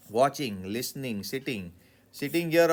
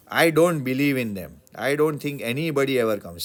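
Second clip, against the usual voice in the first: loud dismissive reply; 2 semitones higher, 8 dB louder than usual. Acted. Acted doubter's reply.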